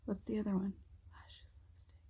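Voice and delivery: muttered whisper while remembering; whispering to herself. Real, not acted. To herself, recalling makeup items in a whisper. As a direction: whisper the list to yourself, searching. Trying to recall third item.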